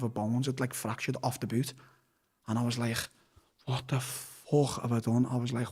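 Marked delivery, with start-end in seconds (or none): 3.7-5.3 stunned. Retold or imitated. Realising mum was hurt.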